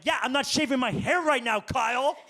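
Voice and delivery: slow, high mock girlfriend sass; hammed-up girlfriend retort; sassy girlfriend. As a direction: drawl it like an unimpressed girlfriend. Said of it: "she's like".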